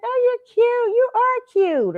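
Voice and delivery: high, drawn-out gushing coo. Retold or imitated.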